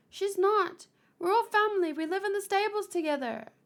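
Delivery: high, loud insistent protest; protesting child. Acted. Kaya covering for Gabi before the soldier, high and insistent. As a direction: jump in urgently to defend a friend.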